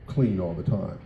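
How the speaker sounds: ordinary delivery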